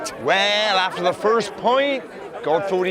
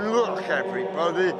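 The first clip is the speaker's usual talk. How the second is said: low and soft; a contrite deity making amends; a quiet, deep, sheepish divine voice; apologetic God; 5 semitones lower, 7 dB quieter than usual. Acted. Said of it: "he bespoke again".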